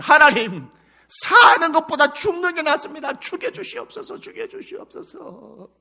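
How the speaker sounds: high-pitched, hammed-up plea to die; Jonah pleading. Jonah asking God for death, high theatrical pleading. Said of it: "하나님 문 앞에 뭘 앉았으면".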